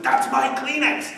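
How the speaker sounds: high, loud, slow indignant whine; mock the hypocrite clutching his Kleenex; possessive outburst. The hypocrite snaps when someone takes his Kleenex, mock high indignant. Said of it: "he's like".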